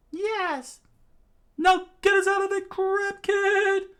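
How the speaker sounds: very high loud bossy yell; high shouted order. Acted. Character orders the kid to get them out, high loud yell.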